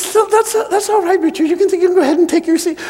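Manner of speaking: high, flustered put-on teacher voice. Teacher brushes off the boy's news, high and flustered. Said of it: "And she says".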